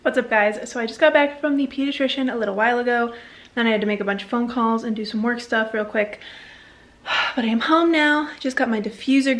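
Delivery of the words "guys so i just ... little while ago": ordinary delivery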